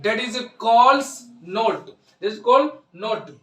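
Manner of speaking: slow dictation. Shown as a demonstration. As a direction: dictate the definition for the notebook. Dictating definition to students. (धीरे-धीरे ज़ोर से लिखवाना)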